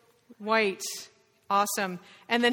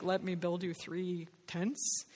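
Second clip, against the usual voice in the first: soft, slow, flustered Peter, lightly voiced; a mild hint of an overwhelmed disciple fumbling for words; bumbling Peter; 4 semitones lower, 7 dB quieter, slower than usual. Teacher voicing Peter overwhelmed on the mountain, soft and slow. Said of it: "Peter's bumbling".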